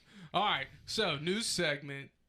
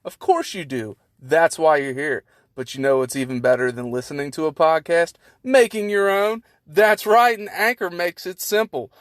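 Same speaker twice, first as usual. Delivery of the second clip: booming radio-ad announcer voice, much louder; loud ad read; 20 dB louder than usual. Pretend. Host pitching the Anchor app to listeners, booming ad voice. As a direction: sell it like a hyped radio spot.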